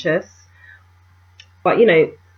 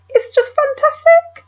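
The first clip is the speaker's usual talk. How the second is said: squealed much higher and louder, drawn out; gushing; 5 dB louder than usual. Real, not acted. Beauty vlogger raving to viewers about her perfume, gushing. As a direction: rave about it like it's the best find.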